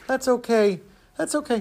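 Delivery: put-on high, cooing comfort for a child; soothing sympathy. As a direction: console a poor little orphan. Ticket seller comforts supposed orphan.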